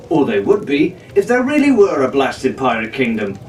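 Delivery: ordinary delivery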